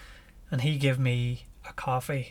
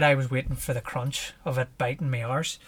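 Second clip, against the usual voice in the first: normal talking voice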